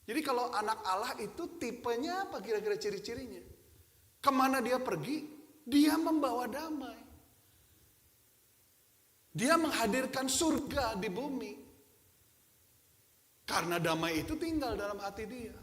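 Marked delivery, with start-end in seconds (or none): 4.2-11.5 high, loud, slow proclaiming; preaching at full force. Acted. Pastor to his congregation, loud and slow with rising conviction. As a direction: ring it out like a preacher's climax.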